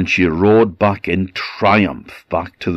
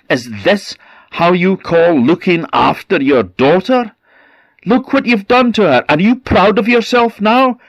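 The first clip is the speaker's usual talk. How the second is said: shrill, much higher and louder tirade; raging at the mother and servants; furious scolding; 13 semitones higher, 5 dB louder than usual. Acted. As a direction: tear into them for their carelessness. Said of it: "she scolded her mother and the servants".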